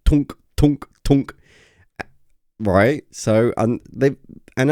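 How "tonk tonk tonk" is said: higher, louder mock mechanical "tonk" clunks; playful sound effect of big switches; imitated switch clunks. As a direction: clunk it out like a heavy power switch thrown three times. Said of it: "where you're like".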